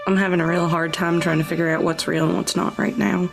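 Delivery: normal talking voice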